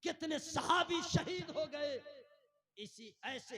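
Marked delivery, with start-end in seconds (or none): 0.0-1.9 high, loud lamenting proclamation; impassioned preaching. Real, not acted. Preacher announcing the companions' martyrdom, impassioned. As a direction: proclaim each martyrdom forcefully.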